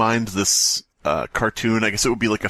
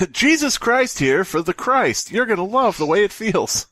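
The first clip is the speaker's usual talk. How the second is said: infomercial pitchman; 10 semitones higher than usual. Pretend. Comedian pitching Jesus's rack like a hammy salesman. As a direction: sell the torture rack like a late-night ad.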